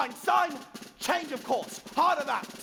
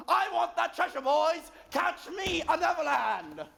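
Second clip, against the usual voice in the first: rallying shout; 5 dB louder than usual. Acted. Captain rallying crew.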